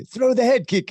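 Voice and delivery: put-on high British accent call. British voice yelling advice at the fight, high British accent. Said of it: "in a British accent".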